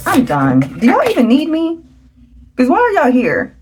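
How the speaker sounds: high, exasperated mock outrage at the cards; playful mock anger. Real, not acted. Reader to her viewers, mock-angry that the cards are too good. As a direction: act fed up in a jokey way. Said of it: "I don't know why I'm getting angry".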